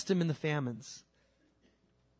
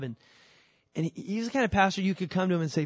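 ordinary delivery

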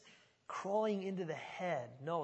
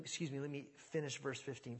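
ordinary talk, nothing marked

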